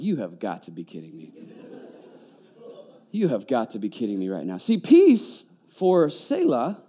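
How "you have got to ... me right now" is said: low, quick, quieter muttered disbelief at his daughter; the worn-out dad can't believe it, said under his breath; exasperated disbelief. Retold or imitated. Tired father to the congregation, low exasperated disbelief.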